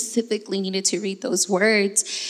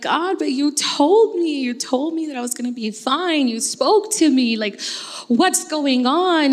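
higher, slow pleading to God; pleading prayer; 5 semitones higher, 3 dB louder than usual. Retold or imitated. Retold desperate prayer to God.

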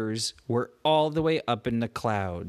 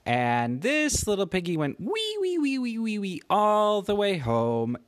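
the wee wees climb much higher, sing-song; playful piggy rhyme; 7 semitones higher than usual. Acted. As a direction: squeal the wee wees up high like a nursery rhyme. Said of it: "Celia and Kira said".

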